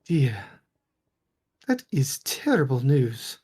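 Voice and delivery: lightly voiced aristocratic lady; haughty noblewoman. Lady Wachter brushing off the stranger's claim, lightly voiced. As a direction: dismiss him with polite disdain. NPC lady answering player.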